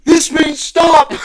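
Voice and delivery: slow, high hammed-up shout; mock yell. Cop yelling at the driver through the window, mock shout. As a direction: bellow the order like an angry cop. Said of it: "he starts yelling".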